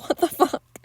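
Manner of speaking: high, baffled reaction through amusement; amused disbelief. Retold or imitated. Podcaster reacting to the chapstick-licking scene, amused disbelief. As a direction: react incredulously, pitch jumping up.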